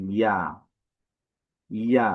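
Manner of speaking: ইয়া said short and clear; name the letter ইয়া for learners. Shown as a demonstration. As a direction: clean model of ইয়া. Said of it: "সেই হরফটির নাম হচ্ছে".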